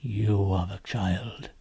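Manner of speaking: slow, low faun voice; grave revelation. Acted. Faun telling Lucy she is the victim, slow and grave. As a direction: drop low and spell it out.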